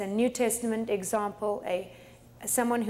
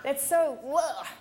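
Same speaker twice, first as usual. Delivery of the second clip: slow, whiny gossip, higher voice; mock gossip; 4 semitones higher, much slower than usual. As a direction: mimic a grudging gossip. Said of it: "tell someone".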